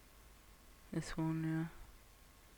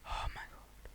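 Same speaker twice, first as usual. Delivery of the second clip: breathless whisper of disbelief; 18 dB quieter than usual. Real, not acted. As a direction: whisper it in awe under your breath. Shocked at rocket weight.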